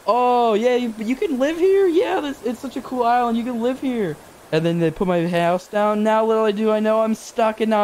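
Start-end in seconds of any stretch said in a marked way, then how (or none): 0.1-4.2 hyped salesman. Retold or imitated. Player mocking Nook's island pitch, high and gushing. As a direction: gush over the island to hook him. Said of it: "it's like".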